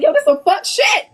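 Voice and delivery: loud, high exclamation; exasperated retold outcry; worked-up outburst. Retold upset outburst while writing.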